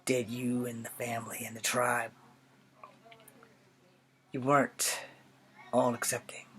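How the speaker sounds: put-on measured, earnest young soldier with long pauses between phrases; Charlie Iron Knife. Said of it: "I'm going to be a character from G I Joe named Charlie Iron Knife".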